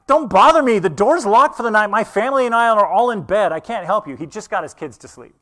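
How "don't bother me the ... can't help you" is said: higher, louder annoyed call from bed; grumpy neighbor. Retold or imitated. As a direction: brush off the knock at midnight. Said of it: "my neighbor's gonna call up from his bedroom".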